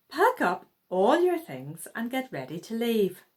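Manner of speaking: usual speaking voice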